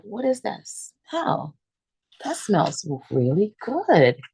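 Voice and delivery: curious wonder. Retold or imitated. Noticing a smell upstairs.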